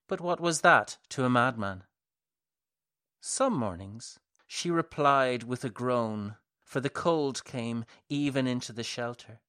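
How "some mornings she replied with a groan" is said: usual speaking voice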